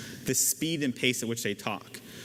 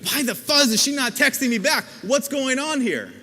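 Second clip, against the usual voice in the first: much higher and louder, panicky; anxious whine; 10 semitones higher, 7 dB louder than usual. Retold or imitated. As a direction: fret loudly about the missing reply. Anxious wondering acted out.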